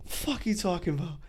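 mock-hyped disbelief. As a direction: blurt it in giddy shock. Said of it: "I got hyped".

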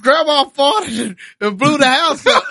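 barely gets the words out through laughter; laughing through punchline. Retold or imitated. Comedian laughing while delivering his own joke's punchline. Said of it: "He said".

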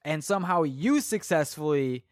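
ordinary delivery

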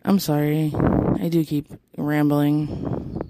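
ordinary talk

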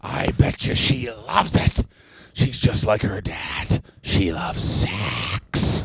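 slow, rough-whispered creepy father; Vince McMahon. Acted. Host playing the boss on his daughter, creepy slow impression. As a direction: draw it out low, swelling on the last word.

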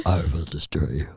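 very deep mock-demonic voice. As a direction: drop way down into a comic demon threat. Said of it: "the other one was like".

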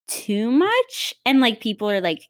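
ordinary talk, nothing marked

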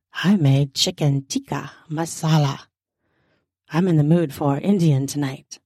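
low, seductive grin in the voice; seductive wife. A narrator's reading. Wife tells husband about dinner, low and seductive. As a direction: drop low and flirt. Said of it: "with a seductive grin".

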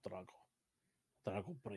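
low, quiet Russian boxer impression; hushed mock-Russian accent; Drago. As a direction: lightly imitate a terse Russian fighter. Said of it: "I need that accent".

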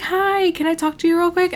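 high mock-innocent neighbor voice; mock landlady voice. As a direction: mimic her sweet little request. Mimicking the landlady's request.

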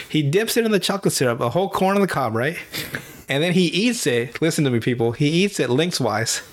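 normal talking voice

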